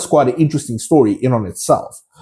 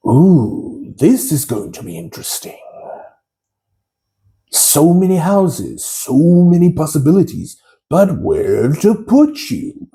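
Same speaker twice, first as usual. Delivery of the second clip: Sorting Hat voice; 3 semitones higher than usual. Retold or imitated. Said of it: "my impression of the famous Sorting Hat voice".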